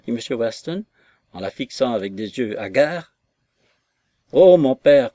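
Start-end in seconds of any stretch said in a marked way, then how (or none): 4.3-5.1 a cry to her father, much louder; pleading cry. Acted. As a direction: throw herself on his mercy. Daughter begs her furious father.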